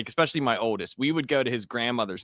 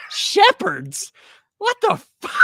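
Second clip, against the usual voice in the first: much higher, louder incredulous yelp; outraged disbelief; 6 dB louder, slower than usual. Retold or imitated. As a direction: squeal it like kids who can't believe it. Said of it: "And we were like".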